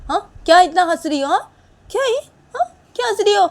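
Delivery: high, loud indignant challenge; irritated demand. Acted. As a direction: snap back at being laughed at. Annoyed at being laughed at.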